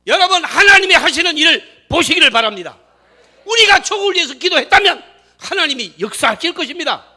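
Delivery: shouted, fervent assurance; impassioned exhortation. Real, not acted. Preacher urging congregation to watch God work, impassioned shouting. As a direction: preach it high and at full volume.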